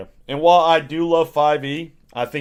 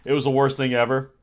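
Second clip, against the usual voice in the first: ordinary talk, nothing marked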